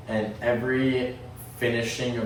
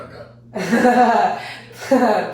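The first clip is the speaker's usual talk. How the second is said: laughing while cheering him on, higher and louder; 11 semitones higher, 8 dB louder than usual. Real, not acted. Friend laughing hard at the Ayatollah bit. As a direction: cracks up and stretches the words out.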